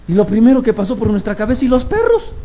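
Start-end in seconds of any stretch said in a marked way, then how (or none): 1.6-2.3 high, rising, disbelieving; surprised question. Retold or imitated. The congregation wondering where the dogs were, high and puzzled. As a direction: ask it in sudden disbelief. Their first thought after the attack.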